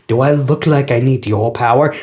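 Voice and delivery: a hint of a gruff brawler brushing him off; tough Knuckles. Knuckles mocking Sonic's attack, lightly low tough voice. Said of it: "Knuckles pulls another stance".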